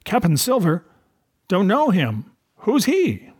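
rising, high mock-puzzled cry; scornful cry. A narrator's reading. Captain mocking the pirate's new title, a high scornful cry. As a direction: feign you never heard the name. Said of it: "cried the captain".

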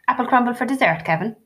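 high, bright-faked offer of dessert; mother forcing cheer to end the gloom; forced cheerfulness. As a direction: push the cheer a bit too hard. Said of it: "with forced cheerfulness".

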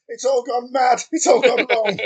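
high, loud theatrical lament; witty period banter. Acted. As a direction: wail it like a melodramatic old gent. Mock despair at the world.

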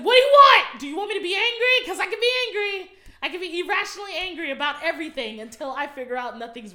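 loud, high, exasperated yelling; mock angry outburst. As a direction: rant as if daring someone to push you. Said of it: "it's like".

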